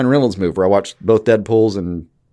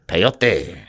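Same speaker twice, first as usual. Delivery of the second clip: Spanish accent. Shown as a demonstration. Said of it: "really bad accent".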